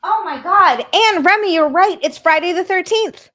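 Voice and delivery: loud excited outburst. Real, not acted. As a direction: blurt it out loud and thrilled.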